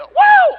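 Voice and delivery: high falsetto woo. Retold or imitated. Preacher imitates [name]'s high woo. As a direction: hit a pop-star whoop.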